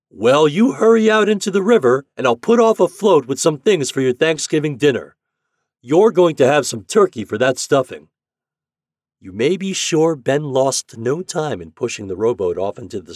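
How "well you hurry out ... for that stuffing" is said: high, very loud instructions; hearty, urgent megaphone shout; captain hailing. A narrator's reading. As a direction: holler good news across the river. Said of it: "raised his trumpet again".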